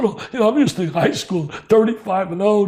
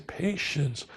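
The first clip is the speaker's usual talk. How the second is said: much softer, calm and unhurried; low-key; 3 semitones lower, 11 dB quieter than usual. Retold or imitated. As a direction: let the word settle quietly. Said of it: "he's very low-keyed".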